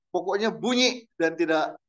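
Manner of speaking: ordinary talk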